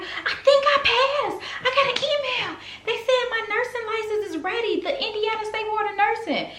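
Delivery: very high, laughing excitement; high laughing news to her boyfriend; excited. Retold or imitated. Telling boyfriend she passed.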